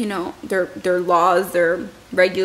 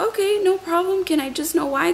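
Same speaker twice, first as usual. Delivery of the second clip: higher, falsely polite question; polite protest; 8 semitones higher than usual. Retold or imitated. Husband politely asking security guard why, in a much higher voice. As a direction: keep it nice but push back.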